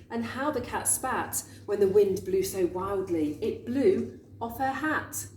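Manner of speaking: usual speaking voice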